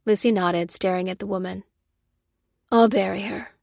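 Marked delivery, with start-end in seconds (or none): 2.7-3.5 slight croak, subdued offer; mildly croaky, understated grief. A narrator's reading. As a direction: offer through a choked-up throat. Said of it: "croaked Ruth".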